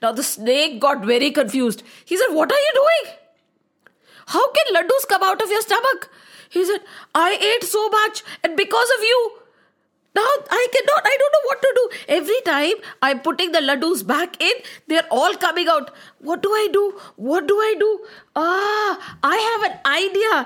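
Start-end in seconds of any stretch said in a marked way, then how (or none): 2.4-6.1 high, baffled voice; puzzled snake. Acted. Snake confused by Gandu. 7.3-20.4 high, rising comic fuss; fretting Gandu. Acted. Gandu desperate then inspired.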